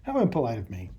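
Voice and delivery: talking while chewing gum; words around a piece of gum. Real, not acted. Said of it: "have a piece of gum in my mouth".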